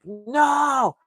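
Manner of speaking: high, drawn-out wail; hammed-up cry of despair; mock anguished cry. Retold despair as fiancée hangs up.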